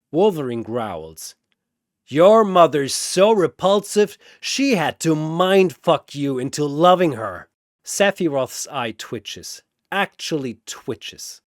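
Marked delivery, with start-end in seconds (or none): 2.1-7.5 higher, louder, jeering insult; mocking taunt. Wolverine taunting Sephiroth about his mother, loud and jeering. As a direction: rub it in, loud and cocky.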